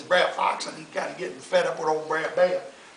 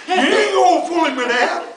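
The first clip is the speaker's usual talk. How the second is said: Brer Bear; 10 semitones higher, 10 dB louder than usual. Acted. Bear scoffing again.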